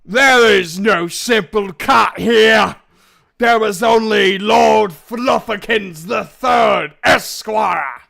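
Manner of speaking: huge, slow, high screeching roar; very loud, drawn-out monster shout; roaring beast. Acted. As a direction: bellow like an outraged lord of the beasts. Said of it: "A lot of roaring".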